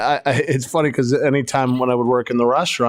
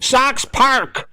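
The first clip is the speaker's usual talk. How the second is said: mocked fan; 10 semitones higher than usual. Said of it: "I hate it when people say that".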